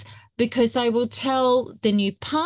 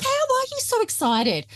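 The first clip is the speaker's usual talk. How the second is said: imitating a girlfriend, much higher and delighted; excited friend. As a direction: squeal it like the thrilled friend on the phone. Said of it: "she's like".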